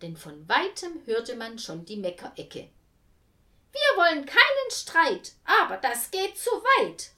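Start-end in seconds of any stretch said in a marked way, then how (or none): 3.7-7.1 high, loud indignant cry; two busybodies crying out in outrage; shouted protest. A narrator's reading. The two women protest loudly in the queue, shouting. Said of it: "riefen die beiden".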